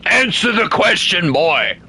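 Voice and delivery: mock stern father. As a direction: bark it like a joking drill sergeant. Mock interrogating a chat viewer.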